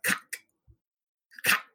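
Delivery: short, abrupt burst on "cock"; aggressive, chopped-off "cock". Shown as a demonstration. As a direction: spit "cock" out to get it out. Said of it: "you have to say it aggressively".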